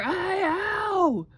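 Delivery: loud, high howl of a tormented giant; roared cry of pain. A narrator's reading. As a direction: yowl at the top of your voice, long and high. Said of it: "roared the flesh lump eater".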